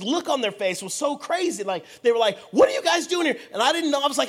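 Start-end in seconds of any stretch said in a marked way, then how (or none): 2.5-3.4 high, loud confrontational question; retold crowd challenge. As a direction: imitate a hostile crowd calling out. Angry crowd confronting them, retold.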